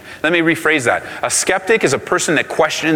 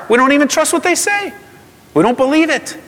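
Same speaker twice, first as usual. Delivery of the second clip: excited; 14 semitones higher than usual. Real, not acted. Preacher's voice climbing in protest.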